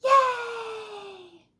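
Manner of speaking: loud, high gleeful yell; a child's delighted scream; joyful shout. Acted. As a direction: shout for pure joy at the beach. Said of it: "Arthur had such a lovely time".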